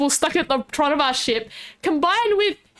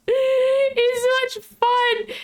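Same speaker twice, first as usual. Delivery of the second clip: laughing; 9 semitones higher, much slower than usual. Real, not acted. Laughing at scuffed session.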